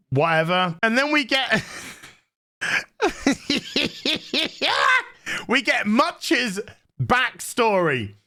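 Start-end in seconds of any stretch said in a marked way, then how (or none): 2.6-5.0 high, stuttering giggle fit; helpless giggle. Real, not acted. Reviewer breaking up before telling Much's backstory, helpless giggles. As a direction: giggle until you can't go on.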